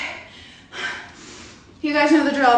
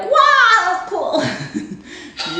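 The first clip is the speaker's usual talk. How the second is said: impressed wow; 8 semitones higher, much slower than usual. Retold or imitated. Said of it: "you were like".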